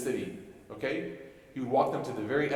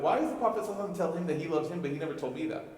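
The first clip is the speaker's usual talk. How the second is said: mildly indignant, higher-pitched quote; jealous complaint; 6 semitones higher, 3 dB louder, much faster than usual. Acted. Companions jealous of the Prophet's love for Mu'adh, mock complaint. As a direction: lightly act out an envious grumble.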